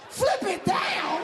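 slow, high disbelieving repeat; incredulous. Real, not acted. Comic to his friend, slow high disbelief. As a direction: say it like you can't believe it.

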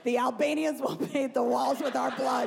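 high, put-on villain voice; menacing toddler. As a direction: deliver it as an over-the-top ominous warning. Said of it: "She just looks at us like".

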